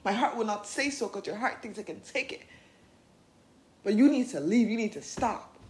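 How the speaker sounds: loud, fierce demand; inner teen standing up. Pretend. Inner teen yelling at her to leave the toxic relationship. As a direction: shout her out of the bad relationship. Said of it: "steps up and says".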